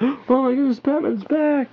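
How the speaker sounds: high, excited mock gasp of a bystander; hammed-up astonished cheer; amazed crowd. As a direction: squeal it like the crowd can't believe it. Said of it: "everyone's like".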